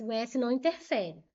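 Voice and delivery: usual speaking voice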